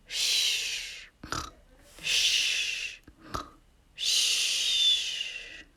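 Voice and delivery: soft whispered shh; shushing. Acted. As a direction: hush the listeners gently. Narrator hushes while Gregory sleeps.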